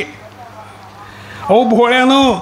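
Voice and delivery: high, slow drawn-out call of wisdom. Acted. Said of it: "माझ्या कानापर्यंत आली". (उंच, संथ, लांबवलेली ज्ञानाची हाक)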